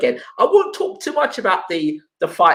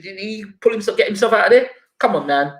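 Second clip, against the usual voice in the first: ordinary talk, nothing marked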